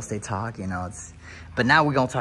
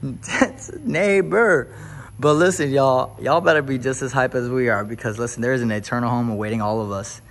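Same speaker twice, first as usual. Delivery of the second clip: preacher climax; 4 semitones higher, 5 dB louder than usual. Acted. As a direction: lean into the church call-and-response. Preaching to viewers.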